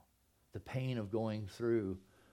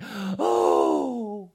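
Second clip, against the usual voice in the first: sharp gasp, then a high loud oh; shocked gasp; 6 semitones higher, 10 dB louder than usual. Retold or imitated. Preacher mimics seeing them reunited, gasping oh. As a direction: gasp as if struck by the sight.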